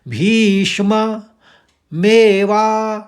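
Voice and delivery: "भीष्म मेवा" chanted high and slow, word by word; slow recitation. Shown as a demonstration. Teacher breaking the third quarter into words. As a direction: say "भीष्म" then "मेवा", slowly and loud. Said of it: "तो तीसरा चरण को देखिए".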